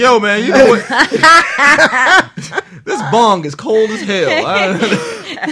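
high, loud, laughing stoner impression. Joking about a cold bong, laughing stoner voice. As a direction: mimic a giggling stoned guy, slow on the punchline. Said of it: "I was like".

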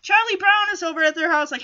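plain reading voice

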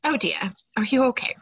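a hint of the prim Queen, rising; a touch of royal concern, lightly done; lightly voiced Queen. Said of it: "the Queen's like".

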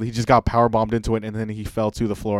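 ordinary talk

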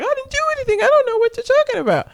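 very high, hurried imitation of her; mock protesting denial. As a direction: plead innocence in a squeaky-high rush. Accused woman's retold denial.

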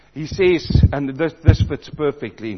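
usual speaking voice

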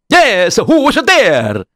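high, slow put-on voice; door-answering figure. Someone answers the rabbi's knock, high drawn-out call.

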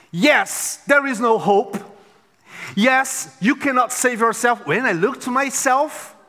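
usual speaking voice

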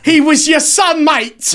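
loud, high, drawn-out bad Cockney; hammed-up Green Street British accent; terrible British accent. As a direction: wail it in an awful London accent. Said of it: "Worst British accent".

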